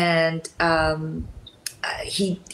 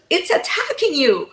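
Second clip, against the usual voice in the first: higher-pitched teasing warning; mock alarm; 9 semitones higher than usual. Co-star teasing her about the snot, in mock alarm. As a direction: imitate someone teasing with pretend alarm. Acts out co-star's joking alarm.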